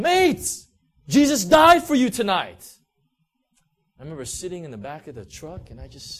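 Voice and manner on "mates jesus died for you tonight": very loud, high-pitched call across a crowd; full-voiced yell of good news; shouted proclamation. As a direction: bellow it out to everyone on the street. Friend repeats shout to crowd.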